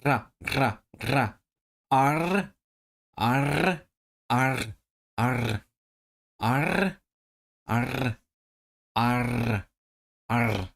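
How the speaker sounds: short ra, then arr, each with a rolled R; trilled R practice. Shown as a demonstration. Learner searching for the lost sound, trilled R. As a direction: hunt for the trill, attempt after attempt. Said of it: "I've lost it".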